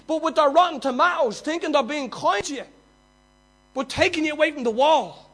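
loud, high, driving sermon voice; full-force preaching. Acted. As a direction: preach it out, building and urgent.

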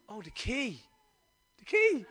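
re-enacted discovery, leaping high on the second "key"; hushed first, then a high squeak of recognition; sudden realisation. Speaker recalls finding the key in her bag, sudden realisation.

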